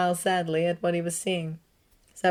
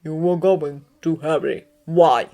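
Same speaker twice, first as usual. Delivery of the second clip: put-on calm old headmaster, the why pressed louder; Dumbledore questioning; 6 dB louder, slower than usual. A narrator's reading. Headmaster questioning Severus at the fireplace, firm. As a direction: question him gently but firmly. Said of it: "asked Dumbledore".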